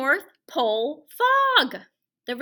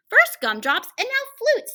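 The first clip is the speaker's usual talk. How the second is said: higher, louder, fed-up Santa; exasperated Santa; 11 semitones higher, 6 dB louder than usual. Acted. Santa fretting over falling flutes, exasperated. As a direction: groan it like a tired Santa. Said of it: "thought Santa".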